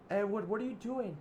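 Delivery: high, loud alarmed cry; shocked yell. Acted. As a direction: shout it in disbelief at a friend. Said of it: "Oliver said".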